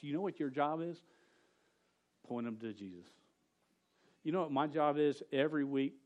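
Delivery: usual speaking voice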